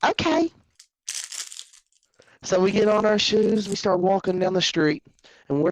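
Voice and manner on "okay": high, eager agreement; happy little kid. Acted. Younger self accepting the crush deal, happy. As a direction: chirp it like a delighted kid. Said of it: "My fat little happy ass said".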